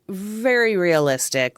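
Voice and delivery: usual speaking voice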